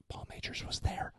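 hushed, breathless whisper about the celebrity; conspiratorial whisper. Retold or imitated. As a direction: lean in and whisper it like a secret.

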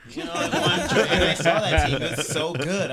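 talking through loud laughter; laughing. Real, not acted. Laughing while praising the team.

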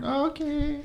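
put-on tiny, high, soft kid voice; small child agreeing. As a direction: agree meekly as a little child. Child agrees to keep secret.

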